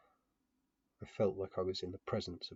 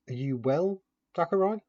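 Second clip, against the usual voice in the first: gentle question; 9 semitones higher, 8 dB louder than usual. A narrator's reading. Gently asks a sullen child.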